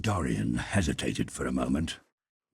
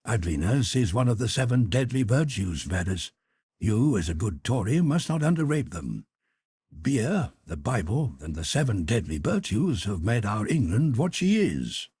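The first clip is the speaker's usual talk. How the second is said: a hint of a drawing-room wit, slightly raised; lightly voiced Lord Henry; 8 semitones higher, 5 dB louder than usual. Lord Henry correcting the Duchess, lightly voiced wit. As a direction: suave gentleman, only a little above the narrator.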